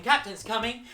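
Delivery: loud, high game call. Shown as a demonstration. Teacher calling captain's coming to the class, loud and high. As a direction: shout the warning to the crew.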